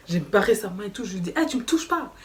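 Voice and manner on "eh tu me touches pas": firm raised warning; warning him off. Retold or imitated. To the man shoving her, a firm warning. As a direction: push him back with your voice. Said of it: "je me suis énervée".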